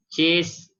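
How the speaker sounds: high, loud demonstration of disgust; angry exclamation. Shown as a demonstration. Teacher demonstrating an interjection for anger and dislike, mock angry. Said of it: "perasaan marah, tidak suka".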